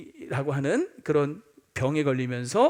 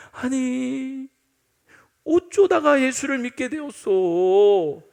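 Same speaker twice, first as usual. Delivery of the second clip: high, slow, drawn-out imitation of disapproval; mock dismayed question; 6 semitones higher, much slower than usual. People questioning the new believer, disapproving and dismayed. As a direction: act out lamenting how it came to this. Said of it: "축하하고 잘했다는 말이 아니라".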